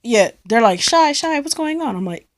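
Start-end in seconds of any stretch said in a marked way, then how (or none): 0.8-2.0 high, urgent calling of her name; worried friends. Retold or imitated. Friends running downstairs to her, calling her name high and urgent.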